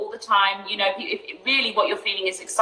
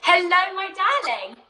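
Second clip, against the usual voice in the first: sweet nothings; 9 semitones higher than usual. Acted. Greeting co-host.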